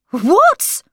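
high, loud shocked question; shocked what. Acted. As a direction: burst out in shock. Brother walking in shocked.

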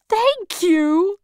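high, lifted, delighted scarecrow; happy thanks. A narrator's reading. Scarecrow thanking Dorothy for the invitation, happy. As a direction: brighten right up with gratitude. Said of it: "said the scarecrow happily".

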